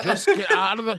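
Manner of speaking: loud, high mock exasperation; exasperated husband. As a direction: imitate a fed-up husband yelling. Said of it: "he's just like".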